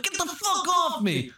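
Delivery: high hammed-up shriek; mock gangster yell. Retelling, Ray Liotta's high mock yell at the cubs. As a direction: squeal at the cubs.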